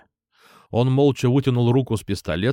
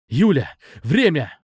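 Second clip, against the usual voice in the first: slightly raised, drawn-out call; pressing call, moderately voiced; urgent call; 3 semitones higher than usual. A narrator's reading. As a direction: urge her to get moving. Urging her to hurry amid danger.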